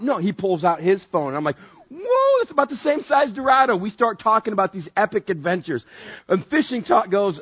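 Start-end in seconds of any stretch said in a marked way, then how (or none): 1.9-3.8 high, whooping mock excitement; excited amazement. Angler seeing the other guy's fish photo, high-pitched excitement. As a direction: burst out high, wowed by the catch.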